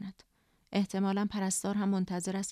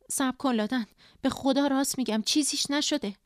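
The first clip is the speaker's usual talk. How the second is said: higher, insistent pleading; urgent reassurance; 5 semitones higher, 5 dB louder than usual. A narrator's reading. Sister stopping Ladan as she runs, urgent reassurance. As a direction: swear to it, urging her to wait.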